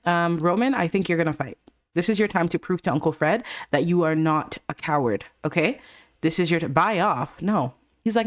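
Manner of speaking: ordinary talk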